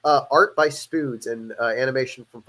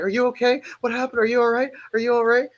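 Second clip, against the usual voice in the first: high-pitched, mock-worried questions; mock frantic concern, pitched up high; imitating freaked-out mom; 10 semitones higher than usual. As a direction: play a panicking mother firing off anxious texts. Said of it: "everyone was freaking out".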